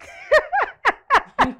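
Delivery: very high, loud burst of laughter; shrieking laughter. Acted. Friends teasing over a rude suggestion, shrieking laughter.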